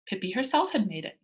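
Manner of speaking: ordinary delivery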